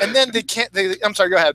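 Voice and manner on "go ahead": normal talking voice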